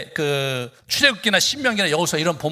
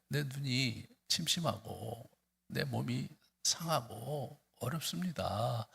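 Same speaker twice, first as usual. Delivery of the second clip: slow, much softer, weary lament; grieving prayer; 11 dB quieter, slower than usual. Shown as a demonstration. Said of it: "이렇게 나오라는 거예요". (느리고 지친 탄원 기도)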